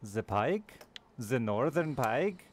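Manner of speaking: hammed-up European accent, drawn out; playful mock European accent. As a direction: tease a European friend in a put-on European accent. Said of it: "Sorry, I shouldn't do accents".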